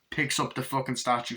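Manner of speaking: usual speaking voice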